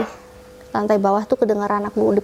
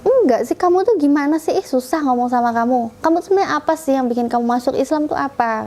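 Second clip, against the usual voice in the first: higher-pitched imitation of a fed-up sister; mock exasperated questioning; retold exasperation; 4 semitones higher than usual. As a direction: start high, complain as an exasperated sibling.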